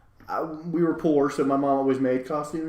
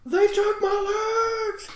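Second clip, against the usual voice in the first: high, drawn-out mock shriek; long high-pitched theatrical wail; imitated screaming; much slower than usual. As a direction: scream it slowly like an agonized victim. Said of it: "And he's screaming".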